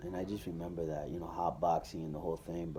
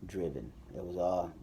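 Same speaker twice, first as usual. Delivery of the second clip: ordinary talk, nothing marked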